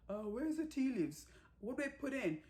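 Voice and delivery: lifted, unsure little voice asking for help; clueless bride voice. Retold or imitated. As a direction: play the new wife who has never cooked, asking sweetly. Rani asks husband about cooking.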